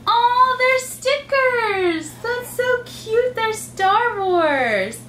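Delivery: high, delighted squeal over the gift; excited gasp. Real, not acted. Mom opening a gift for her son, delighted. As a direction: gush over the stickers like a thrilled mom. Child opening Easter presents.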